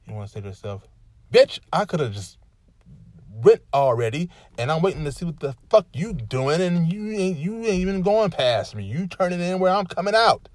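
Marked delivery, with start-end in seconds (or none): 1.3-10.5 loud, high furious rant at a driver; cursing out the driver with no turn signal, much louder; frustrated rant. Retold or imitated. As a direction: let the road rage rip. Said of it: "It's like that kind of frustration".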